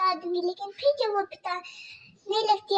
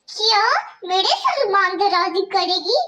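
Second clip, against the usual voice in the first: harsh stepmother scolding; 6 dB louder than usual. Acted. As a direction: bark orders at the girl like a cruel stepmother.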